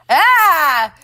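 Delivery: high, loud mock "ah!"; short imitated freak-out cry; imitated startled yelp. Rider behind her startled by a bee, mock yelp. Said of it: "screaming's not the right word. It's more like a".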